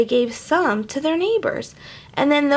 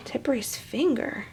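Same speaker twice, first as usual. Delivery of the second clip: Tippery's finger said much softer; quiet; 9 dB quieter than usual. Shown as a demonstration. Reader rereads Tippery's finger softly. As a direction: drop to a soft murmur on Tippery's finger.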